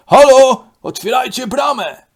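loud call at the gate. Acted. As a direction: shout to be let in. Woła przy bramie. (głośne wołanie pod bramą)

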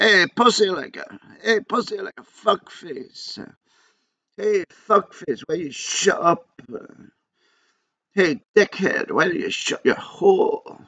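high, slow slurred heckling; drunk man roaring. Retold or imitated. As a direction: jeer drunkenly from the back seat. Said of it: "roaring and shouting from the back".

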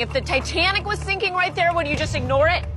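loud, high, outraged scolding; loud and high, demanding they care; indignant outburst. Real, not acted. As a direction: shame the diners for eating. Outraged at diners ignoring dog.

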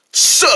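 "sir" as a slow, low "suh"; affected pronunciation. Shown as a demonstration. Soki insisting on his new title, "sir" as a long "suh". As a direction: drop the r and stretch "sir". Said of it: "that's pronounced".

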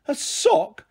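high, shocked disbelief; appalled. A narrator's reading. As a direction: recoil at the idea. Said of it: "Bindweed sounded appalled".

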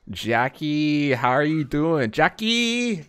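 high, loud drawn-out greeting; excited name call. Real, not acted. Streamer greeting Jackie in chat, excited name call. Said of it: "can't help but say your name like that".

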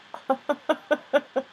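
high staccato giggle; real run of short, high ha-ha-ha. Real, not acted. Laughs at live technical mishap. (petit rire aigu saccadé)

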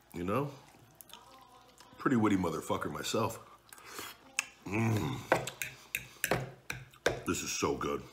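eating between words; talking while chewing. Real, not acted. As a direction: mouth partly full of food. Seen 2: Bit pasta at -1.0, twirls fork and chews while speaking.

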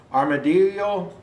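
ordinary delivery

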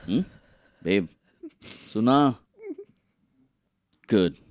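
ordinary talk

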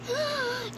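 high, soft pretend gasp; delighted gasp. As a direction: gasp at a lucky find. Owner sees the found cat.

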